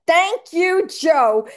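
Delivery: high, loud gushing thank you; mock-gushing gratitude, high and loud. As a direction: thank him with glee. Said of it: "I was like".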